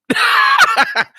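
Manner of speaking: loud high burst of laughter. Real, not acted. Laughing at absurd chat.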